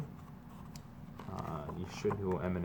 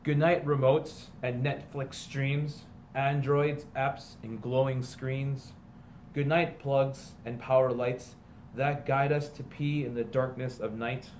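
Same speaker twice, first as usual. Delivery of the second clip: slow, sing-song picture book read-aloud; storybook reading; 4 semitones higher, slower than usual. A narrator's reading. Teacher reading a parody picture book to students, storybook voice. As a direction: read it like a bedtime story to the class.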